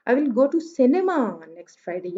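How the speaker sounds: cinema drawn out as the prominent word; stress on cinema. Shown as a demonstration. Teacher shows where she will go, stress on cinema. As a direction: lean on cinema, rest of the sentence unaccented. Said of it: "यहाँ पे cinema पे जो है वो stress आ रहा है".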